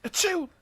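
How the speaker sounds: loud sneeze; a sudden sharp sneeze. Real, not acted.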